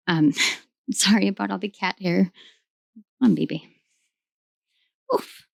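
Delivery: ordinary talk, nothing marked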